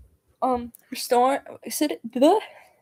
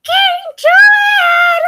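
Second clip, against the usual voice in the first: very high, loud, drawn-out squeal; Mort from Madagascar; 12 dB louder, much slower than usual. Acted. Streamer imitating a cartoon character for viewers, squealing Mort voice. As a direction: shout it in a tiny high cartoon voice. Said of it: "as Mort from Madagascar".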